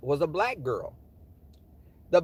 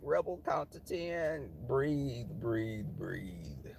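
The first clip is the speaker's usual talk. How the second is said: soft, slowing down and sinking lower; self-calming; 4 semitones lower, 9 dB quieter, much slower than usual. Retold or imitated. Rebel telling self to breathe before answering, hushed. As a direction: talk yourself down under the breath.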